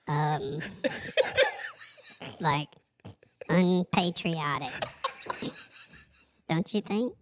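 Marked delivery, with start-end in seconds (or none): none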